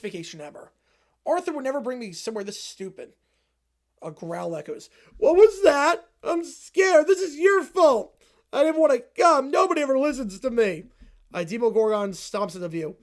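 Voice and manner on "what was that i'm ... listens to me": put-on scared whiny kid. Kid hears growl.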